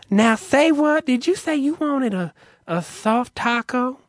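high, baffled drive-thru clerk impression; mock an effeminate man asking, pitch way up; feminine male clerk. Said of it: "they asked him to repeat it".

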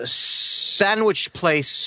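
"sandwich" very slow and carefully enunciated; over-articulate "sandwich", sounding the d, drawn out; affected pronunciation. Real, not acted. Caller showing off proper speech to host, "sandwich" over-enunciated. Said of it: "Why are you saying it like that?".

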